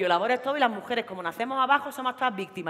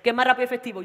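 usual speaking voice

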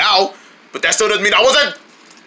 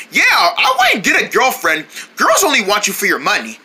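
ordinary talk, nothing marked

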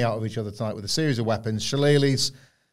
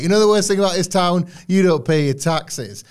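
wrestling heel promo; 5 semitones higher, 5 dB louder than usual. Retold or imitated. IRS heel cutting a promo on the town, mock heel bark. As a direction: bark it at the crowd like a villain. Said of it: "he was like".

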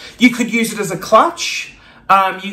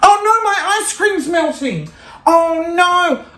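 much higher mock panic; mock alarm; 9 semitones higher than usual. Vlogger faking shock at fake ice cream, mock alarm. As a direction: pretend to panic over it.

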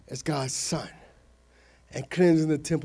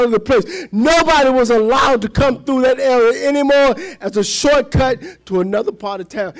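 raised, driving sermon storytelling; impassioned preaching; 4 semitones higher, 6 dB louder than usual. Real, not acted. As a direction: build the temple chaos louder and higher. Preaches a vivid retelling.

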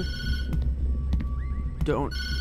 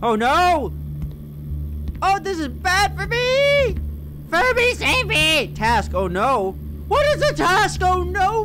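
panicked; 10 semitones higher than usual. Real, not acted. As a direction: panic and yell for help. Panicking at enemy.

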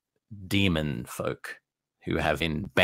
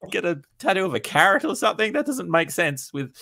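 much higher and louder, fast, disbelieving; mock incredulous; 12 semitones higher, 4 dB louder than usual. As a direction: act out a shocked friend with a squeaky put-on voice. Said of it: "it would be kinda like".

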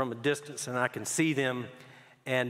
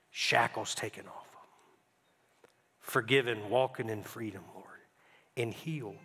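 soft, earnest prayer voice; closing prayer; 3 dB quieter than usual. Acted. Pastor praying over the congregation at the close, earnest prayer. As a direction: pray over the congregation gently.

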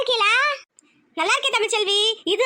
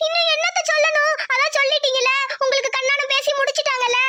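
higher, hurt and teary; sulking girlfriend crying at the news; tearful; 3 semitones higher than usual. Acted. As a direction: reproach him through tears. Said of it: "எதுக்கு இப்ப அழுவுற?".

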